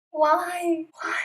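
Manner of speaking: a high, loud, whining why why; exasperated why. Retold or imitated. Friends dreading what she did. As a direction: moan it in disbelief. Dread after hearing the cry.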